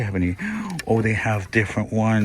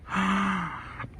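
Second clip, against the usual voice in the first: delighted gasp; 12 semitones higher than usual. Real, not acted. Sees cute decor, gasps.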